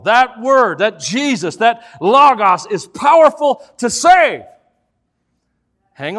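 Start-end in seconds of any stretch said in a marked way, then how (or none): 0.0-4.4 loud, rising sermon climax; preaching at full force. Acted. Preacher driving home his point to the congregation, loud. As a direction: build it up like a revival preacher.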